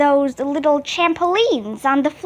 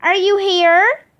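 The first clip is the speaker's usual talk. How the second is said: higher call with a rising question; searching, raised-pitch call; 4 semitones higher than usual. A narrator's reading. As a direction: call across the gym to find someone. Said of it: "called Miss Daisy".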